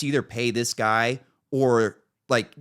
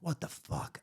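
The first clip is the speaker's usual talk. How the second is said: softer, whispered disbelief; whispered curse; 7 semitones higher, 13 dB quieter than usual. Retold or imitated. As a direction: hiss it under your breath. AC iced up again.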